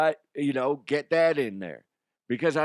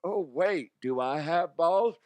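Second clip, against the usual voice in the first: higher-pitched, unsure imitation; mock questioning; 5 semitones higher than usual. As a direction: mimic someone doubting himself. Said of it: "He's, like, questioning".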